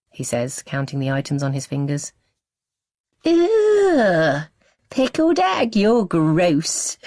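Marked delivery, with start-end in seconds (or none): 3.3-7.0 high squealed disgust; disgusted little girl. A narrator's reading. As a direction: recoil from the pickled egg like a little sister. Sister disgusted at egg.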